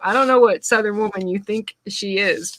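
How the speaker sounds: ordinary delivery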